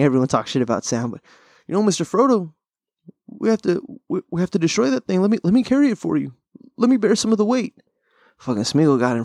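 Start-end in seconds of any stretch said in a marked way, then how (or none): 1.7-7.7 raised-pitch, earnest imitation of a loyal helper; imitated pleading Sam. Loyal friend offering Frodo help, pleading imitation. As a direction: plead in a higher put-on voice.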